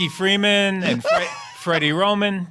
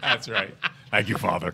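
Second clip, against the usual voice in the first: imitating the son; 9 semitones lower, 4 dB quieter than usual. Mock Wolfman line to father.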